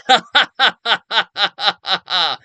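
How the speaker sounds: high, loud hammed-up evil cackle; mock evil laughter in a villain voice. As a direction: cackle like a cartoon baddie. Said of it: "you hear Gang Green laughing".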